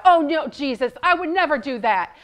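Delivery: loud, eager protest; loud indignant promise; blurting out. Acted. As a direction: blurt it out, sure of yourself. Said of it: "he blurted right out".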